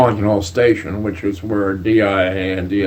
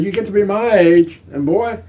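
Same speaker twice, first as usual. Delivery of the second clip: amused quoting; 10 semitones higher, 3 dB louder than usual. Retold or imitated. General's advice, retold amid laughter.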